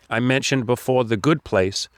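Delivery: ordinary talk, nothing marked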